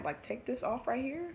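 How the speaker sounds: ordinary talk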